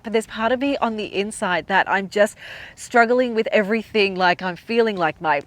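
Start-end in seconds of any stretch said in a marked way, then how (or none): none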